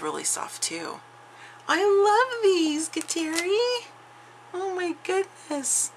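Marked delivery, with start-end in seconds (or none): 1.7-5.9 high, slow, drawn-out cooing; swooning delight. Real, not acted. Crafter thanking a friend for gifted yarn, high slow swooning delight. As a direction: melt over the yarn in a soaring slow coo.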